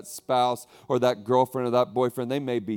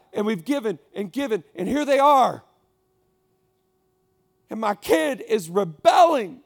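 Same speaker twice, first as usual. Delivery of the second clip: higher, louder, building to a shout; exasperated parent building; 8 semitones higher, 7 dB louder than usual. Acted. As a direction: vent it until it breaks out. Parent voiced venting about rebellion.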